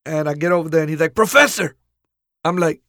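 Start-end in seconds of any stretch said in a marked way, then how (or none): 1.1-1.8 a loud, high call of his new name. Retold or imitated. Boss greets new hire with a loud call. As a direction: bellow the nickname across the office.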